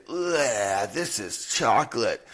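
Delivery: a groaned ugh over the chocolate; mock disgust. Parent faking dislike of chocolate to his kids, mock disgust. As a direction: pretend to hate it for the kids. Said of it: "take a bite of chocolate and say".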